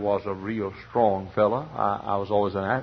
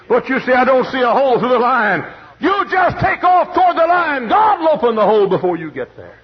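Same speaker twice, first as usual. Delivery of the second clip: high, loud, shouted preaching; full-force preaching; 10 dB louder than usual. Acted. Preacher shouting encouragement to the congregation, high and loud. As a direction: drive it home at the top of the voice.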